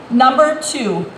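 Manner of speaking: slow, deliberate stress on Number two; emphatic repeat. Real, not acted. Candidate hammering tax ranking to forum audience, slow emphatic. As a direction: let Number two land hard, word by word.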